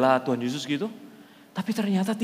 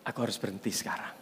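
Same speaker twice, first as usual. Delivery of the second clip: lower, soft inner resolve; resolute self-talk; 7 semitones lower, 9 dB quieter than usual. Retold or imitated. Believer telling himself to stop sinning, low and soft. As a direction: tell yourself quietly and firmly. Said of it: "ngomong sama diri Anda sendiri".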